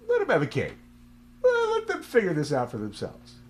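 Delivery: put-on God voice, high and loud. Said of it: "God was like".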